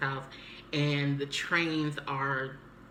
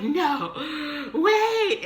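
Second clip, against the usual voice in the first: high, loud mock-panicked yell; acted frantic plea; desperate calling out; 12 semitones higher, 6 dB louder than usual. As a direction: cry out to stop a closing gate. Calling out as gates close.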